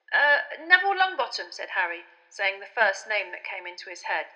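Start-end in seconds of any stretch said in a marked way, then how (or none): 0.1-1.5 put-on boy's voice, hesitant. A narrator's reading. Harry voiced in the story.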